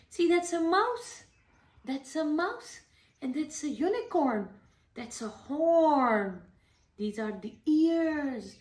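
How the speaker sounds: put-on mother pointing at pictures for her daughter in baby voice. Shown as a demonstration. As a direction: coo it out slowly to a toddler like a picture book. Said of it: "if you're talking in baby voice".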